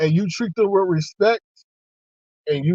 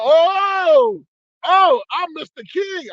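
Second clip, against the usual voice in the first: high, loud pleased surprise; delighted surprise; 11 semitones higher, 4 dB louder, much slower than usual. Retold or imitated. Hearing the cop call him Mister King, high delighted surprise. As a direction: savour being called mister.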